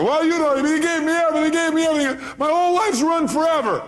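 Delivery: hammed-up impression of a despairing woman; imitating caller. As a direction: whine it like the heartbroken caller. Quoting the distraught caller.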